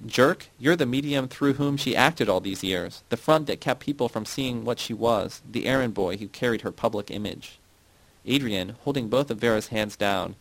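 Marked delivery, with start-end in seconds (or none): none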